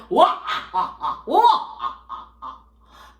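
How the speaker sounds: loud mock evil laugh; big staged "wah-ah-ah" cackle; villain laugh. Rooster gloating over the burning bridge, villainous laugh. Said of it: "he's smiling and laughing".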